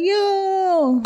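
high put-on "Yo"; mock friend "Yo". Friend greeting her, imitated in a high voice. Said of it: "He's like".

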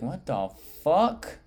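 baffled and incredulous, every word dragged; stunned disbelief. Retold or imitated. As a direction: stretch it out, retelling how stunned you were. Retelling his stunned reaction.

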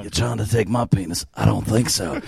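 lightly voiced action-hero tough guy; a hint of a Statham-style movie hard man; mock Jason Statham. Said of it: "Starring Jason Statham".

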